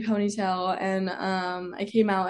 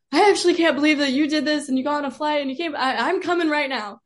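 higher, louder, rushed quote of a worked-up dad; dad freaking out; 6 semitones higher, 5 dB louder, faster than usual. Retold or imitated. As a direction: imitate a freaked-out dad, raised voice, hurrying. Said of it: "he was freaking out".

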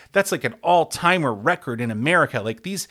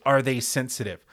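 ordinary delivery

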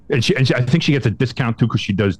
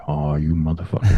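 muttered curse; 7 semitones lower than usual. Retold or imitated.

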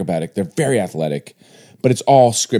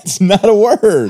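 laughing; 10 semitones higher, 6 dB louder, slower than usual. Real, not acted. Laughing at invented word.

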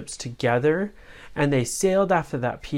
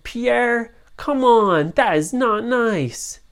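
scolding parent voice; 9 semitones higher, 6 dB louder, slower than usual. Acted. Family scolds pirate.

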